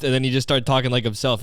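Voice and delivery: ordinary talk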